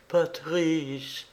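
high, faint, drawn-out call; small frightened woman's voice; faltering, fearful voice. A narrator's reading. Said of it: "bégaya Coralie".

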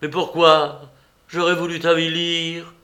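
loud, anguished outburst; beside himself. A narrator's reading. Lover cries out at Juliette, beside himself. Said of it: "s'écria Léonie hors de lui".

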